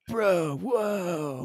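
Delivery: hammed-up stoner "whoa"; dude bro. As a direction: imitate a dazed dude-bro accent. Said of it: "total dude bro accent".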